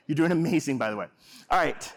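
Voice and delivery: ordinary talk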